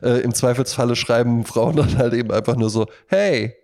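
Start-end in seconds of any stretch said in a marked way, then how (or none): none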